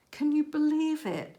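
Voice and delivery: high, loud disbelief; astonished. Real, not acted. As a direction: sell the amazement of the find. Found money, astonished.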